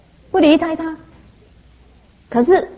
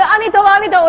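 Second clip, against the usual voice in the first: high, louder rapid-fire chanting; imitating eager children; 9 semitones higher, 3 dB louder, faster than usual. Three children reciting for a coin each, eager mock chant. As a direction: rattle it off like kids after a reward.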